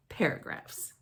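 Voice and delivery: Paragraphs breathed in a voiceless whisper. Acted. Kid narrator whispers Paragraphs in disgust. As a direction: whisper Paragraphs like a dirty word.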